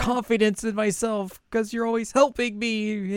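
high, plaintive little voice; put-on helpless pleading; insecure confession. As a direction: pour out self-doubt in a high whine. Acts Chisato's insecure confession.

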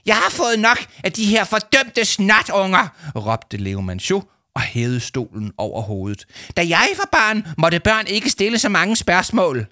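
rage, chair overhead; ranting shout. A narrator's reading. Adviser raising a chair, ranting shout.

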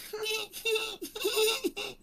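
put-on very high, soft childlike giggle; imitated child giggle. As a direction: mimic a little kid laughing far off. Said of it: "this sound like child laughter".

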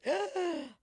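sharp high gasp of desire. Retold or imitated. The mobster gasping at first sight. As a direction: gasp as if struck by her beauty. Mobster sees Sarah Jessica Parker.

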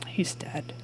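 breathy near-whisper, solemn; hushed whisper. Acted. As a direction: break the news of the death softly. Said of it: "is like".

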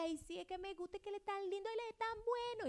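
put-on high, swooning girly voice; mock smitten girl. Pastor mimicking a church girl gushing over her boyfriend, high and swooning. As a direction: gush over him like a lovestruck girl. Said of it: "Pero tú en tu carne dices".

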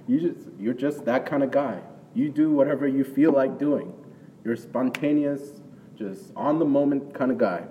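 preacher lightly voicing Jesus scolding Peter fondly; a lightly voiced Jesus.

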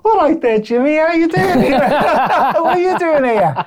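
high-pitched, hammed-up hello to an old mate; surprised mate's greeting. Musician greeting another band's player in San Francisco, mock-surprised. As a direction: imitate bumping into a pal far from home. Acting out surprise meeting a friend abroad.

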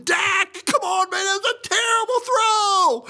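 very high-pitched frustrated fan yell; acted-out sports fan's outraged shriek; yelling at player. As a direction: holler it at the TV in exasperation. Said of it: "I'm sitting over there yelling".

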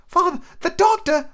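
put-on a boy trying to object; high young lad's voice; young Jim. Narrator voicing young Jim.

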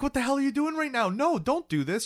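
high-pitched imitated protest; pissed. Husband stopping wife calling her friend, high pissed protest.

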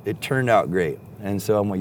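plain reading voice

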